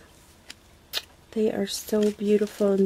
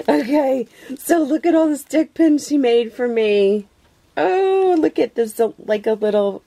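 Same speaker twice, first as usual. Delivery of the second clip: excited; 6 semitones higher, 5 dB louder than usual. Real, not acted. Showing the pins she got.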